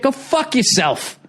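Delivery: exasperated dismissal. Acted. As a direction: stretch it out in indignant disbelief. Indignant rant at the clinic.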